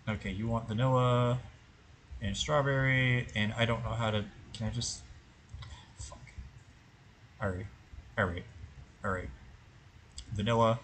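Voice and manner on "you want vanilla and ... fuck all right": normal talking voice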